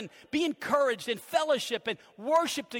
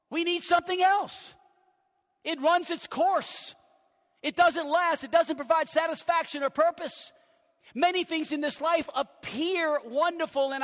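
rising, louder preaching, slowing on key lines; building preacher's fervor; 3 semitones higher, 4 dB louder than usual. Acted. Pastor warning the congregation, preaching with rising force. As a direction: press the point home to the pews.

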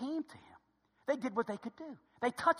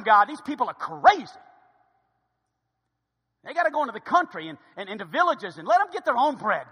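loud, hammed-up complaint with bursts; agitated disciples; 5 semitones higher, 15 dB louder than usual. Disciples urging God to get rid of hungry crowd, agitated. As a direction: protest to God in exasperated outbursts.

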